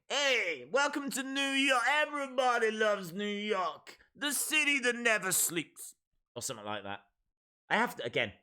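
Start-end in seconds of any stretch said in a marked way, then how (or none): 0.1-5.9 raised-pitch attempted New York accent; amateur high-pitched New York impression. Shown as a demonstration. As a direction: greet them as a brash New Yorker. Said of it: "Maybe it's New York".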